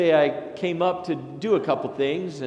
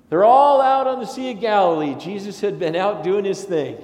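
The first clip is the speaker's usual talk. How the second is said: excited storytelling; 6 semitones higher, 7 dB louder than usual. Real, not acted. Launching into the Bible story.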